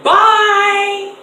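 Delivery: high, loud, dismissive goodbye; sassy dismissal. Retold or imitated. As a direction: wave them off with sass. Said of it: "I was like".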